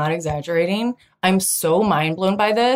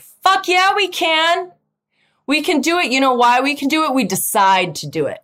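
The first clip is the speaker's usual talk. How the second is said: emphatic; 6 semitones higher than usual. Retold or imitated. Boyfriend's emphatic reply.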